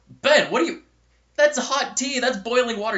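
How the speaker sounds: high, loud retold scolding; alarmed disbelief. Student to friend drinking boiling tea, acted alarmed disbelief. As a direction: exclaim in acted shock at his foolishness.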